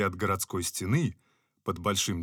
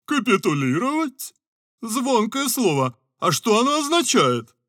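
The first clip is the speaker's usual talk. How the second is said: higher, louder mock-puzzled voice; naive Scarecrow; 9 dB louder than usual. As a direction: savour the strange word. Scarecrow mocks innocently. (наивно-удивлённо Страшила)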